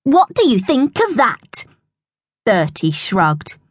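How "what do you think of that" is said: high-pitched gloating question; crowing. A narrator's reading. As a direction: gloat over your dog's trick. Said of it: "crowed Royston".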